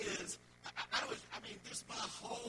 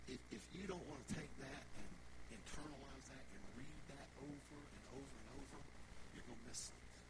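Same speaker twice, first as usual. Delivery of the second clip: low, soft, intimate teaching; quiet, earnest pastoral aside; hushed reflective preaching; 7 semitones lower, 7 dB quieter than usual. Real, not acted. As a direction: drop right down low and soft to let it sink in.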